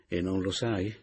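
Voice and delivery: usual speaking voice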